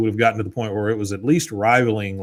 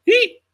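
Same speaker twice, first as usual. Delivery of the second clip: tiny shrill eep, far above his own voice; high squeak; 4 dB louder than usual. Retold or imitated. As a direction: squeak it out like a startled man. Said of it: "you hear Bill, like".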